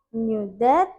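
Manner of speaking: ordinary delivery, nothing marked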